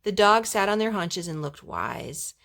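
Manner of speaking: ordinary delivery, nothing marked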